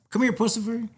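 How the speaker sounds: high-pitched pet-calling voice, retold; calling a cat. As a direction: call the cat over in a high voice.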